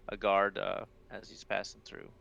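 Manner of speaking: ordinary delivery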